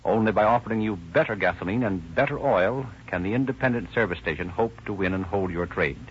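ordinary talk

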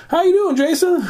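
high-pitched imitated hello; retold greeting with laughter in it; hearty greeting. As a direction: mimic an old acquaintance hailing you. Retold surprise greeting in a bar.

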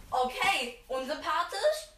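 ordinary talk

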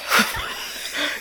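soft unvoiced laugh; breathy laugh. Real, not acted. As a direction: laugh quietly under the breath. Laughing at friend's ghost story.

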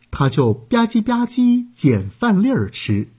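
usual speaking voice

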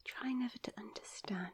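breathy murmured line, barely voiced; whispered to herself. Real, not acted. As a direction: mouth the quote quietly while thinking. Guessing quote to herself.

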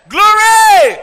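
a full-throated high cry of praise; joyful shout. Real, not acted. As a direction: shout glory to the rafters. Preacher's exclamation.

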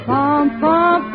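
ordinary talk

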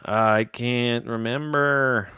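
slow, dragged-out groan of frustration; drawn-out frustration. Real, not acted. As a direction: stretch it out like you're exasperated. Frustrated at forgetting the topic.

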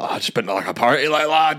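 fumbling a fake Scottish accent to blend in; bad Scottish accent. Pretending to be Scottish to the lads on the tube, in a bad Scottish accent. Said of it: "such a bad Scottish accent".

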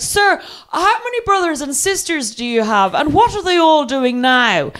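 high, drawn-out mock interest; over-keen questioning. Drunk passenger distracting the driver, over-politely. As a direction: feign drunken fascination to buy time.

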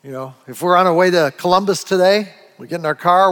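ordinary talk

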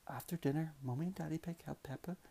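soft, quick whisper; whispered bedtime line. A narrator's reading. Dad whispering the bedtime ending to his child. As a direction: hush it as the story winds down.

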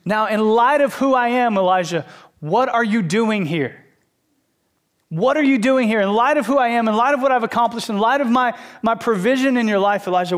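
a passionate plea from God; loving but urgent. Acted. God urging Elijah to remember his power, mild fervent ask. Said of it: "lovingly ask you".

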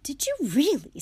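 high, loud, thrilled question; delighted father forgetting himself; eager. A narrator's reading. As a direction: burst out with boyish eagerness. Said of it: "said Mister Weasley eagerly".

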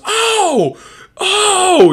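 very high, loud frantic cries; desperate high yelps. Retold or imitated. Swollen Hitch at the pharmacy, shrieking high desperate cries. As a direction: yelp like you're desperate for relief. Allergic Hitch grabbing Benadryl.

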